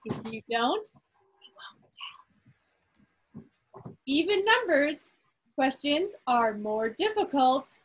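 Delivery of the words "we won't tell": whispered like a shared secret; conspiratorial whisper. Pretend. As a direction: drop to a playful secret whisper.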